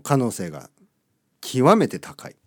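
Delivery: usual speaking voice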